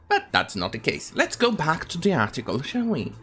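ordinary delivery

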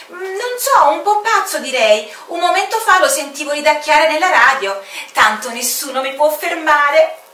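wide-eyed officer telling of a madman; high bewildered report; puzzled officer. Acted. Officer describes the odd pilot.

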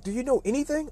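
high, disbelieving put-down; retold exasperated question. Coach to the clueless walk-on, high exasperated disbelief. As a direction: imitate someone baffled by such ignorance.

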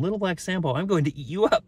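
high-pitched storybook tiger threat; threatening tiger. A narrator's reading. Tiger telling the boy he'll eat him, high threat.

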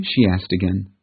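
ordinary delivery, nothing marked

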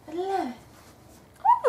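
slow, high, swooning delight; drawn-out high gush of love. Real, not acted. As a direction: melt over it, stretched out. Gushing over unboxed skirt.

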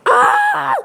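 shrill startled scream; high scream. Real, not acted. Hit by the tank, a high scream. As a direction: let out a piercing scream.

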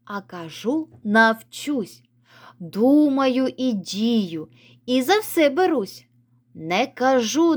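put-on slow, sing-song recital for kids; poem reading. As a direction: read the poem slowly and brightly to children. Reads poem aloud to children. (повільне декламування вірша для дітей)

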